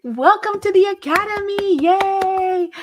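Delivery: high, loud, cheery greeting; excited welcome. Real, not acted. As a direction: welcome them like a big celebration. Welcoming a new academy member.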